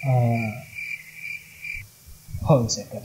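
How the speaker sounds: low, hesitant angel, mildly acted; a hint of a sceptical angel; lightly voiced angel. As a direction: stall doubtfully as a mock gatekeeping angel. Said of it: "Terus malaikat kayak".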